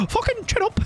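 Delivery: high squeaky puppet voice; a failing Elmo impression. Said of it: "I can't do it".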